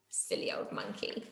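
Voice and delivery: soft whispered aside. A narrator's reading. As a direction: whisper it to the children. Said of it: "narrator aside to children".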